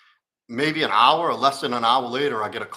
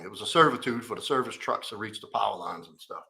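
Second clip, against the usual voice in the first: usual speaking voice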